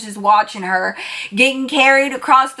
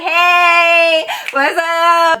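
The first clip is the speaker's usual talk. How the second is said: tipsy cheerful greeting; 5 semitones higher, 4 dB louder, much slower than usual. Retold or imitated. Tipsy self greeting guys cheerfully.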